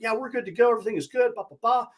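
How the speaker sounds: high, airy brush-off; breezy fake confidence. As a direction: toss it off like all is fine. Mocking peers' reports.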